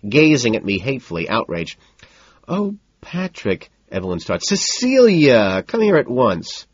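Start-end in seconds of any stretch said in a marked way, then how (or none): 2.5-3.7 high, slow reproach; exasperated high-pitched sigh of a name; whiny socialite. A narrator's reading. Evelyn starts reproaching Patrick. 4.5-6.7 high, drawn-out command; high, insistent order; imperious summons. A narrator's reading. Evelyn summoned or summoning at party.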